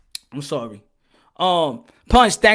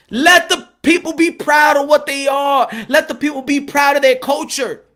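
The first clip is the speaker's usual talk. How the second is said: loud, higher, pleading for pride; impassioned shout; 4 semitones higher, 4 dB louder than usual. Real, not acted. Preacher urging the crowd to let people be proud, shouting. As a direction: shout it out like a rally cry.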